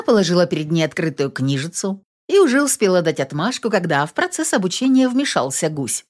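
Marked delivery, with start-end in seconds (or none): none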